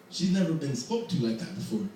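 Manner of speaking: ordinary delivery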